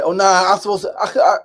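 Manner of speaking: high, loud, stammering objection; mock indignant protest. Cousin disputing his share of the house sale, indignant. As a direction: splutter in imagined outrage. Imagined money quarrel between cousins.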